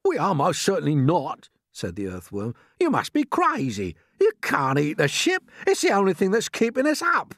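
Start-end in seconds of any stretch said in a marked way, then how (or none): 0.0-1.5 loud, pitched-up rebuttal; contrary earthworm. Acted. Earthworm denies grasshopper's claim of rescue, indignant. 2.8-7.3 loud, pitched-up protest; indignant earthworm. Acted. Earthworm warns against eating the ship, indignant.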